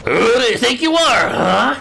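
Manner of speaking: tough challenge. Acted. Character confronting the punks.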